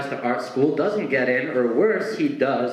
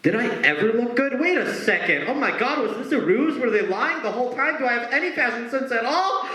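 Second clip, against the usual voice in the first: much higher, rising hammed-up panic; mock panic; 10 semitones higher than usual. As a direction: spiral into comic alarm. Spiralling self-doubt.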